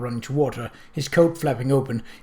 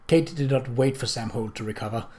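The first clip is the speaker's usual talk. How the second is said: usual speaking voice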